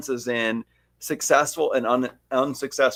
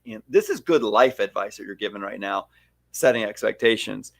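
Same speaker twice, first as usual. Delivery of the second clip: usual speaking voice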